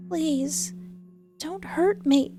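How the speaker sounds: high, frightened plea; weak plea. Acted. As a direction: beg with the last of her strength. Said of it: "with the little strength I have left".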